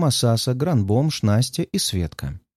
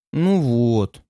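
disappointed. Acted. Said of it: "разочарованно бросает серьги".

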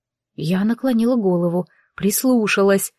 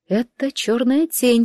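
usual speaking voice

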